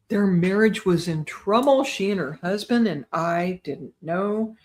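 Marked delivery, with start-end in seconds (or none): none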